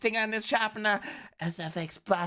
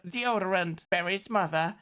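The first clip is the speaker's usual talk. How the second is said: usual speaking voice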